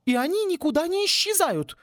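hammed-up furious professor yelling; furious dean. Dean finishes thought. (разъярённая деканша, высоко и громко)

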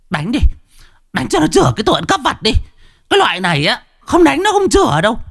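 high, fast, shrill shriek egging them on; shrieking woman. Acted. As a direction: screech at the thief like a spiteful village woman. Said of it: "Có tiếng người đàn bà rít lên". (giọng đàn bà rít lên the thé)

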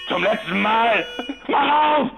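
last warning through the door, shouted; threatening countdown. Acted. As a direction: bark out the count before you fire.

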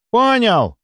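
high shouted acknowledgement; distant called reply. A narrator's reading. The running doctor answers from round the corner, calling back. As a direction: call back over your shoulder while leaving. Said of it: "донеслось из-за угла".

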